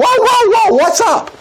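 high-pitched drawn-out whoas; acted alarmed protest. Daniel stopping his executioners on the way, hammed-up high alarm. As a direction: halt them in exaggerated high alarm.

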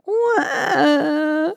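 put-on high, piercing baby cry; baby wail. Baby mouse wails in the dark, high piercing cry. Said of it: "cried Baby Mouse".

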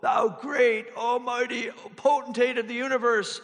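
lofty, hammed-up King James prayer voice; mock flowery old English prayer. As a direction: pray like a pompous Shakespearean orator. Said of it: "start addressing God like".

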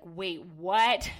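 loud, startled outburst on the last word; shocked husband. Retold or imitated. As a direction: imitate a husband taken aback. Husband's shocked reply to her plan.

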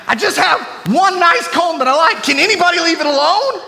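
high, loud comic complaining rant. Pretend. Preacher rants to church about his missing comb, high and loud. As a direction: whine about the stolen comb at full volume.

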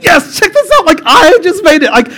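high, squeaky, bragging sheep voice; excited sheep. Acted. Sheep brags about making the ark, high and thrilled. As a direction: gloat in a high cartoon bleat. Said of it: "Like, he's so excited".

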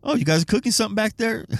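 high, fast, curious customer voice; quick nosy customer asking; mock customer. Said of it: "They're like".

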